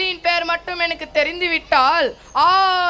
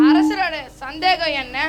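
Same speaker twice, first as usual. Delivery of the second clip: usual speaking voice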